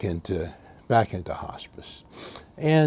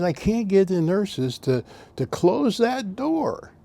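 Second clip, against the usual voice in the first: put-on confused old man; 9 semitones higher, slower than usual.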